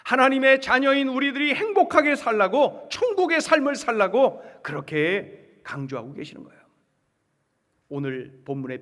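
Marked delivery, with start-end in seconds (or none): 0.1-4.4 loud, raised preacher's voice; high, forceful proclamation; emphatic preaching. Real, not acted. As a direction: drive it home to the congregation. Preacher stressing God's wish for people.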